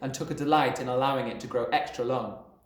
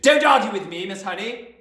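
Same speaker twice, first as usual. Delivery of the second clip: loud, high roar; thundering; 9 semitones higher, 9 dB louder than usual. A narrator's reading. Headmistress silencing the teacher, thundering. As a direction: thunder it down at her. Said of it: "the headmistress thundered".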